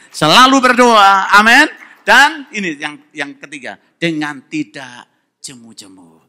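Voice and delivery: loud, slow, drawn-out exhortation; slow pulpit shout; preaching at full force. Acted. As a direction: call it out to the congregation.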